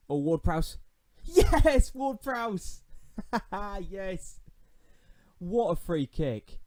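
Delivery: goal celebration. Real, not acted. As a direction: scream with delight at the goal. Celebrating a free kick goal.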